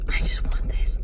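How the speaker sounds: whispered, exasperated plea; frustrated whisper. Real, not acted. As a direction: drop to a pleading whisper in frustration. Frustrated after failed grocery search.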